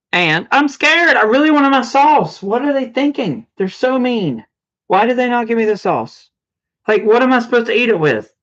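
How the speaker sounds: mock upset over missing sauce; whiny complaining. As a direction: whine like a cheated child. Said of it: "So upset".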